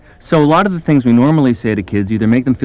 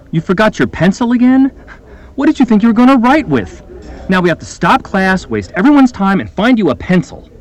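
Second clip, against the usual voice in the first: much higher, louder scolding accusation; blaming and accusing; 9 semitones higher, 3 dB louder than usual. Acted. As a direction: scold the kid, exasperated and accusing. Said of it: "first I'll blame and accuse".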